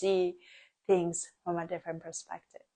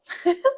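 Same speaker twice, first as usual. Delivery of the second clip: high laugh; 5 dB louder than usual. Real, not acted. Laughs at "cry a little.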